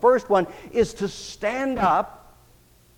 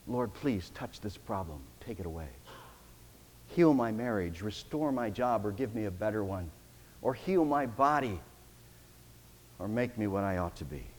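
quiet prayer; 7 semitones lower, 9 dB quieter than usual. Acted. Congregant's voiced plea to Lord.

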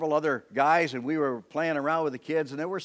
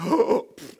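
sharp high gasp for air; imitated gasp; 9 semitones higher, 6 dB louder than usual. Preacher reenacting his own gasp while nearly drowning. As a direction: gasp as you surface.

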